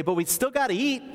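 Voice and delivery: high, complaining busy sister; mock-whiny hostess; Martha's complaint. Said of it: "Martha would say".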